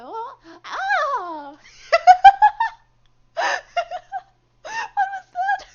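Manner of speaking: real shrieking laughter, very high and loud; words squeezed through helpless high laughter; laughing hard. Real, not acted. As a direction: crack up at your own voice slip. Voice demo collapses into laughter.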